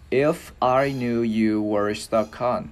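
very slow, drawn-out English demonstration; careful, measured teacher's pronunciation; slow model phrase. Shown as a demonstration. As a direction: stretch each word for learners to copy.

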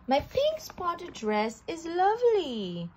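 a mild joyful exclamation; exclaimed with joy. A narrator's reading. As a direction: burst out delighted with the new dress. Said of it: "Sugar exclaimed with joy".

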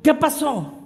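high, loud challenge; hammed-up aggressive retort; mock confrontational shout. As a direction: snap round with an acted shout. Acts startled man snapping round.

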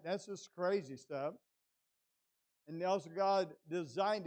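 loud, weighty pulpit declarations; emphatic preaching. Real, not acted. Preacher telling congregation God designed and limits adversity, loud and emphatic. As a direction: hammer each point, stretching key words.

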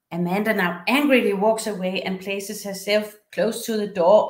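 usual speaking voice

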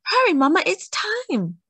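put-on high, urgent child's shout; excited shout. A narrator's reading. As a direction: shout for mama to hurry. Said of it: "Dahlia ran outside and shouted".

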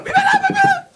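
put-on baby talk. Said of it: "בוא תראו איזה יופי".

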